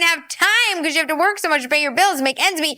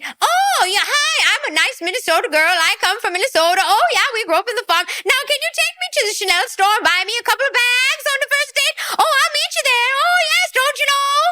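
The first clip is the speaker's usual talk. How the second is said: high, fast, hammed-up Minnesota accent; Minnesota farm girl; 11 semitones higher than usual. Farm girl on a first date asking for bags, exaggerated Minnesota accent. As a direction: chirp it in a mock Minnesota singsong. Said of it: "A farm girl from Minnesota talking like that".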